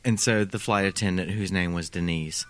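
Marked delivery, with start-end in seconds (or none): none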